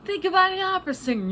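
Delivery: quick high excited teacher voice; imitating teacher. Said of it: "he would just do, do all these silly comparisons".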